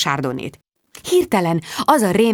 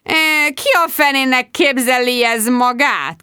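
outraged, drawn-out and higher; indignant outburst; 6 semitones higher, slower than usual. A narrator's reading. Sharon fuming to her friends about the caller, indignant. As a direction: huff it in slow disbelief.

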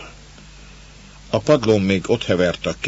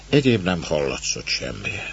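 usual speaking voice

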